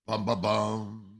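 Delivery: slow, mock-ominous bum-bum-bum; dramatic sting. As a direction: sound out a suspense cue by voice, drawn out. Mock suspense sting before in-law problem.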